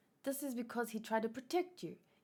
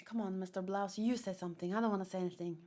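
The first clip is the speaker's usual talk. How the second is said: ordinary talk, nothing marked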